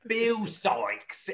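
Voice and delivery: theatrical mock Cockney; Cockney accent. Shown as a demonstration. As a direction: play the Cockney villain from a school musical. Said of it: "like a Cockney accent".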